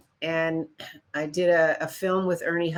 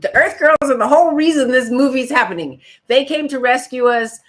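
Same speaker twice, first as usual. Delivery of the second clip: retold protest, higher and louder; angry insistence; 6 semitones higher, 7 dB louder than usual. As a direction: demand your part back. Confronting director, got mad.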